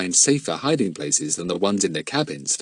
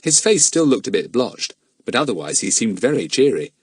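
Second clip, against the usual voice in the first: usual speaking voice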